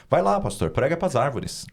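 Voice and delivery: high, jeering taunt; mocking jeer. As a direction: tease the pastor in a high taunting voice. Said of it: "Aí ele zombando".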